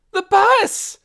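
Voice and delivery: high, loud scream of the bus; girl screaming at the oncoming bus. Acted. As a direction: scream it in alarm. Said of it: "screamed Nikki".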